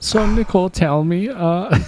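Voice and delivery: mock interviewer in a German accent; high, hammed-up German accent. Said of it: "you have to talk like that".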